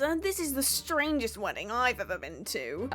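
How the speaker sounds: put-on high, wavering old man voice. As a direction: play a doddery old man commenting. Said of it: "I played the old man".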